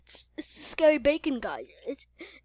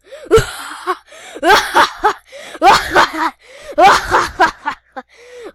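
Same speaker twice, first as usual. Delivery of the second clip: long, loud, high cackle; hammed-up maniacal laughter; evil laugh; 7 semitones higher, 11 dB louder than usual. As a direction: cackle in repeated villainous bursts. Villain reveals himself, laughs.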